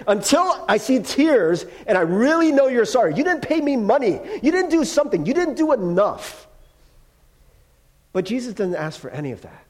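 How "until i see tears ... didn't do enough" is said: higher-pitched, piling up complaints; acted-out indignant list of grievances; mock demanding. Offended person demanding tears and money, mock indignant. Acting someone demanding proof of sorrow.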